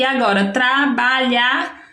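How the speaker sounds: trabalhar drawn out slowly, each syllable spaced; slow syllabified word. Shown as a demonstration. Teacher to pupils, spelling out trabalhar very slowly.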